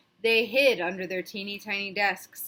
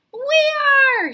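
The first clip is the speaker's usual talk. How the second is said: eager students; 14 semitones higher, 12 dB louder than usual. Acted. Students answer together.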